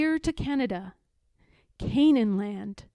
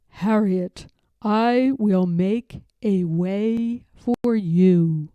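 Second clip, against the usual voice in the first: lower, louder, slow promise; voice of God; 3 semitones lower, 7 dB louder, slower than usual. Acted. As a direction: assure her solemnly.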